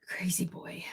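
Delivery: soft hushed whisper; whispered aside about the pet. Real, not acted. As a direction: barely breathe the words.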